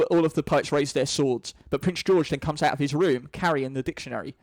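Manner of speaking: ordinary delivery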